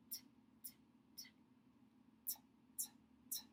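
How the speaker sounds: crisp repeated "t" taps, tongue tip on the ridge; T sound demo. Shown as a demonstration. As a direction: tap out "t, t, t" clearly, one at a time. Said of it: "ready to say a T".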